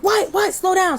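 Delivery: high-pitched retold interruption; confused mama. Mama tries slowing panicked caller, confused. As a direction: imitate mom cutting in, high voice.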